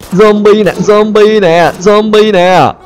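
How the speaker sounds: high taunting. Real, not acted. Shooting zombies, taunting them.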